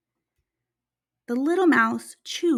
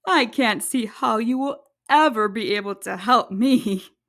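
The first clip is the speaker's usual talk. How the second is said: lower, amused lion voice; lion voice, smiling; 4 semitones lower, 3 dB louder than usual. Acted. Lion sparing the mouse, low and amused. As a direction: smile through it like a kindly big lion. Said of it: "he smiled".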